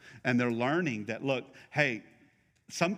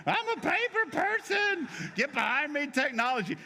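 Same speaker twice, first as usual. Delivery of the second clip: proud paper person; 11 semitones higher than usual. Pretend. Preacher mocks the paper people in a much higher, proud voice. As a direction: play a smug paper-calendar loyalist. Mocking the paper-calendar crowd.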